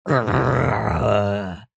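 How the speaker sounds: low imitated monster growl. Husband growling to scare his wife, low mock-monster growl. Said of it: "I'd yell".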